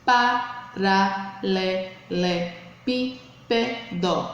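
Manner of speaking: "Paralelepípedo" very slowly, each syllable apart. Shown as a demonstration. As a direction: count out "Paralelepípedo" beat by beat. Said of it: "formada por sete sílabas". ("Paralelepípedo" bem devagar, separando as sílabas)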